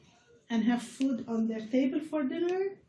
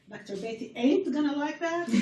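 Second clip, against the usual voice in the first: a hint of a Southern accent, half joking; light mock Southern accent; 4 semitones higher than usual. Shown as a demonstration. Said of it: "I'm gonna say that in my southern learned accent".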